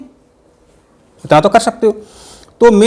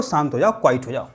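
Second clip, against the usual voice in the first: soothing hush; 4 semitones lower, 8 dB quieter, faster than usual. Shown as a demonstration. Soothing an angry person.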